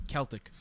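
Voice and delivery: "Celtic" with a hard k at the start; correcting pronunciation. Shown as a demonstration. Co-host correcting a mispronounced word, "Celtic" with a hard k. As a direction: say "Celtic" as KEL-tik. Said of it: "you were mispronouncing that fucking word".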